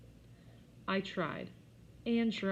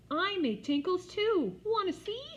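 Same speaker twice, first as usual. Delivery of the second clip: put-on very high, loud, slow child voice; proud little girl; 14 semitones higher, 6 dB louder than usual. A narrator's reading. Jenny offering to show, high loud proud. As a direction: boast like a cheeky toddler.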